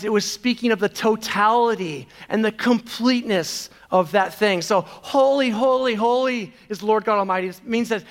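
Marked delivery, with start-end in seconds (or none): 4.8-7.5 angels crying out. Retold or imitated. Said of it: "the angels crying out".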